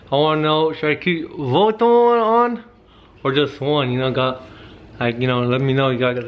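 slight lisp from tooth covers; teeth-covered speech; speaking with grills on. Real, not acted. Seen 4: Gold grills worn on upper and lower teeth throughout; R frames only hold them.

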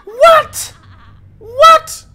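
very high, loud squeals; shrieking disbelief. Real, not acted. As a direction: screech in disbelief. Disbelief at death.

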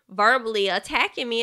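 ordinary talk, nothing marked

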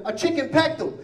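high mocking nurse voice. Nurse telling the doctor, mock high voice. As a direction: toss off the nurse's line in a high voice. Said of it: "the nurse said".

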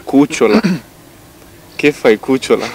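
a light Italian lilt on cucciola; lightly put-on Italian accent. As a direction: say it like an Italian flirting. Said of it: "spune-o ca italian".

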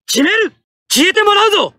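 high, forceful determined shouting; fierce combat lines. Acted. Pilot charging to finish the enemy, high determined shouts. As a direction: drive it home like a charging attack.

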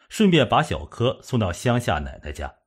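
usual speaking voice

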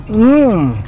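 high rising mmm of taste; delighted hum. Real, not acted. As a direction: savor the bite aloud. Tasting tofu, delighted.

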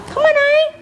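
very high coaxing call. Real, not acted. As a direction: lure the horse over. Coaxing the horse.

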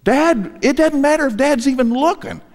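put-on trusting little child. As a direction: sound like a toddler sure his dad will catch him.

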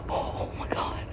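very soft, awed whispering; shocked whisper. Real, not acted. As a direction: breath-only whisper after a fright. Recovering from the mouse scare.

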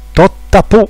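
put-on small child proudly announcing; little boy's babble. A narrator's reading. Toddler Albertino proudly shows the dripping heater. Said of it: "disse Albertino".

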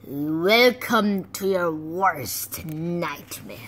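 slow, low menacing threat; menacing villain. Acted. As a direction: threaten them slowly. Menacing monster line.

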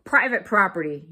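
loud, gruffly indignant warning; storybook cactus yelling; grumpy yell. A narrator's reading. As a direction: warn off a trespasser loudly. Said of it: "yelled Hank".

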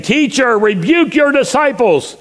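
high, slow, indignant call; acted-out Pharisee's shouted demand; calling from the crowd. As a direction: call out a rebuke over a crowd. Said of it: "called to him from the crowd".

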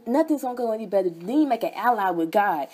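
normal talking voice

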